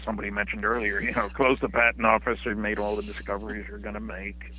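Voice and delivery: ordinary talk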